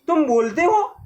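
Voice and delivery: put-on girl amazed the letter box speaks; astonished girl. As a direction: gasp out disbelief that it talks. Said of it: "लड़की".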